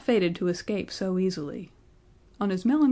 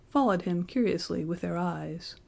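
usual speaking voice

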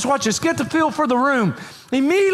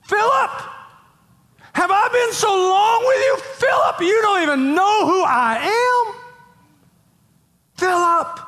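emphatic shout; 9 semitones higher than usual. Acted. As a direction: shout it at him in disbelief. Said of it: "very emphatic".

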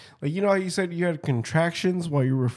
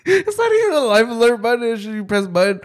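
usual speaking voice

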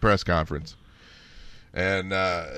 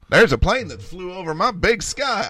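mock whiny voice; 8 semitones higher than usual. Mocking a Texan complaint.

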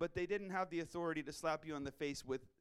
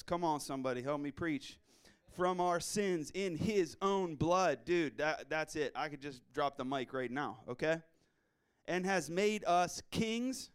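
scripture preaching; 5 dB louder than usual. Real, not acted. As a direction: proclaim each phrase of the verse. Preaching through Revelation verse.

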